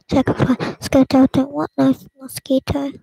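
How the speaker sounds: ordinary talk, nothing marked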